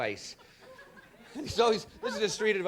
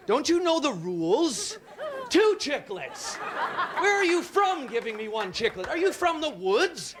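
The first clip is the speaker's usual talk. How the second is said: indignant rant; 9 semitones higher, 4 dB louder than usual. Acted. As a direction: scold him for one chiclet. Ranting at gum guy.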